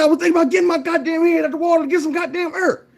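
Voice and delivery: high, loud, fast outraged yell; the half-drowned man yells at the mentor, fast and outraged; frantic shout. Acted. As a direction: rattle it off, furious and desperate.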